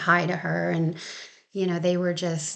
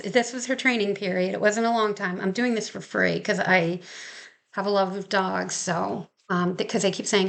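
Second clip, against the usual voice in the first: normal talking voice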